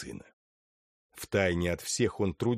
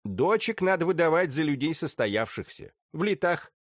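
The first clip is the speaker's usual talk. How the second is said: matron voice; 8 semitones higher than usual. Acted. Sukhovskaya advises on daughters.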